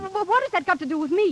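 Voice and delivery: high, rapid, stammering question; flustered disbelief. Acted. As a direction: stumble over the first word in alarm. Pupil startled by the news.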